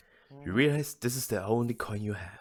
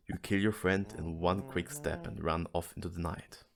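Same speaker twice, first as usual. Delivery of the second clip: usual speaking voice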